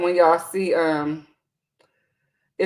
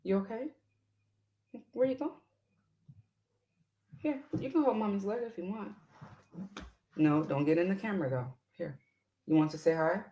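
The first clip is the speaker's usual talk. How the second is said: hushed gentle coaxing, much softer; 12 dB quieter, faster than usual. Real, not acted. Mother hushing her toddler beside the camera, soft and coaxing.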